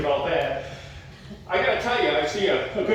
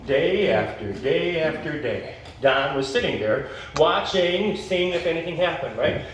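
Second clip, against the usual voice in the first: ordinary delivery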